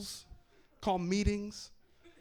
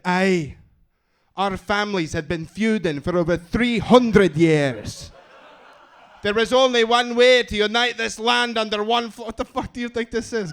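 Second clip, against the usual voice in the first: put-on booming, slow Scottish accent, epic and grand; Scottish warlord proclaiming an ancient feud, loud; 6 semitones higher, 12 dB louder than usual. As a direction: deliver it like a Scottish clan chief rallying the land. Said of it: "I love the Scottish accent".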